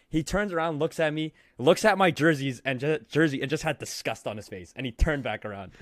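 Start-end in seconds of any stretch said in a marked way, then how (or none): none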